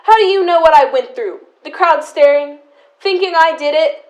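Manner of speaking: loud, rising fury at her sister; shouting at sister who claims to understand; angry outburst. Acted. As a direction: hurl it back at her, loud and bitter.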